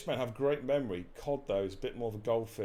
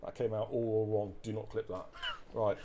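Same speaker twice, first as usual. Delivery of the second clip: normal talking voice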